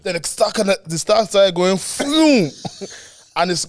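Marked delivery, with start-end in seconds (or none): 1.8-2.5 high imitated swerving whoosh. Telling friends about the crash, high mock whoosh. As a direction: mimic the car drifting off. Car drifting into other lane.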